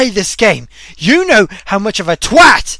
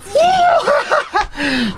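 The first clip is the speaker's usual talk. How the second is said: high, shouted laugh; high laugh at imagined revenge; gloating laugh; 12 semitones higher than usual. Real, not acted. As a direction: burst into vengeful laughter. Imagines beating the boss.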